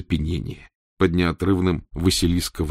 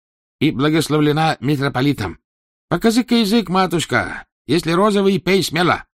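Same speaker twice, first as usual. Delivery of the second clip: higher, eager fawning healer; fawning doctor voice; 9 semitones higher, 4 dB louder than usual. Acted. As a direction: coax the empress sweetly.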